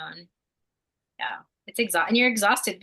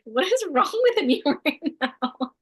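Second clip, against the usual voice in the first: laughing disbelief; 9 semitones higher, slower than usual. Retold or imitated. Retelling her own shaking.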